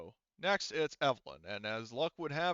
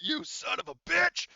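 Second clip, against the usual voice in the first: high, loud, drawn-out furious yell; angry shout; 8 dB louder than usual. Acted. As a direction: scream it at the enemy. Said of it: "He's like".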